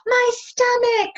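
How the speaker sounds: very high slow pained wail; loud high slow hurt cry. Retold or imitated. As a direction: wail in pain. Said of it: "she said".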